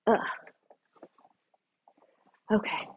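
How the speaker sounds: breathless after squatting; out of breath. Real, not acted. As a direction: talk while catching your breath. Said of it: "I'm very out of breath".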